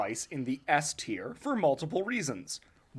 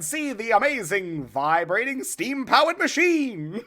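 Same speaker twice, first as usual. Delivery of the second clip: carnival barker; 7 semitones higher, 4 dB louder than usual. Pretend. Continues barker pitch.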